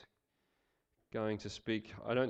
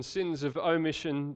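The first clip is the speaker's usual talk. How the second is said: ordinary talk, nothing marked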